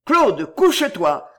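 loud, urgent order; shouted warning. A narrator's reading. Guard about to fire warns the girl, shouted warning. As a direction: call out to get her down. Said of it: "cria Dagobert".

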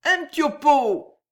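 high, insistent wheedle; high drunken refrain; stubborn repetition. A narrator's reading. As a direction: keep begging obstinately for a drink. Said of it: "Noyelle qui répétait".

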